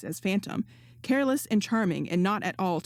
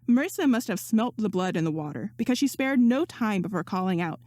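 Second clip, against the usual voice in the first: usual speaking voice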